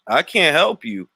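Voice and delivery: ordinary talk, nothing marked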